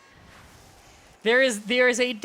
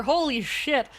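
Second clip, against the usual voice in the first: ordinary talk, nothing marked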